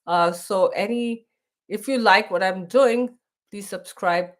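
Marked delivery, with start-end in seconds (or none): none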